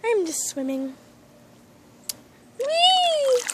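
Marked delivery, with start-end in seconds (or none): 2.6-3.4 high, bright squeal of delight; gleeful squeal. Pretend. Pet splashing in its pool, gleeful squeal. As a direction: squeal with joy as you splash.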